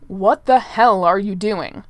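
loud, slow, furious bark. A narrator's reading. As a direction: bark it out in fury. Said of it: "barked the prince, ... sounding furious".

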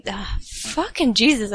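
ordinary delivery, nothing marked

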